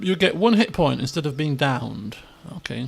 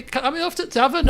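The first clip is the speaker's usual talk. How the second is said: high, quick pretend request; hopeful companion; 8 semitones higher, faster than usual. Companion asks the half orc about the tavern, pretend eagerness. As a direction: ask eagerly in a high, put-on voice.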